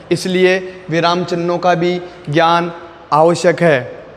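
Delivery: normal talking voice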